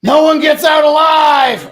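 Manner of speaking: loud, slow, high spooky shout; mock horror shout. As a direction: boom it like a horror trailer. Mock horror line in dark.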